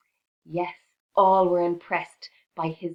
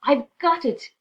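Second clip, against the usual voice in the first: high, loud eureka; a mouse's sudden eureka, higher and louder; excited mouse; 8 semitones higher, 7 dB louder than usual. A narrator's reading. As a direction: burst out with the bright idea. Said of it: "jumped from the covers".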